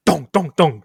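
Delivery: loud, punched "dong" beats; imitated knocking. Man pounding on a door, voiced as loud knocks. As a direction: pound out three heavy knocks with the voice. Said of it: "and he goes".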